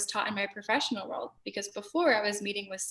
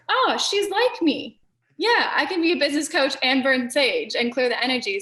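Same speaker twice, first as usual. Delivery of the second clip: gushing quoted reaction, starting much higher; delighted recognition; 3 semitones higher, 4 dB louder than usual. Retold or imitated. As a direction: imitate clients thrilled to find a kindred spirit. Acted clients' delighted recognition.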